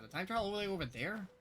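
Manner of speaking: ordinary talk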